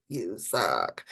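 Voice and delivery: low, quiet, cutting insult; harsh inner critic. Pretend. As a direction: sneer it like a nasty inner voice. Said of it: "darkest moments to go".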